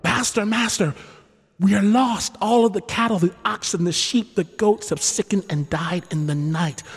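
high, frantic servant cry; a servant in despair; terrified servant reporting deaths; panicked servant. Acted. Servant brings terrible news.

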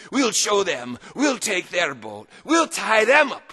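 rally the men like a blustering captain; roaring. Acted. Dirty Joe firing up his crew, mock roar. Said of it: "he roared".

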